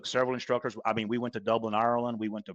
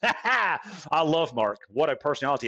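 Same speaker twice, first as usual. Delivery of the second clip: ordinary talk, nothing marked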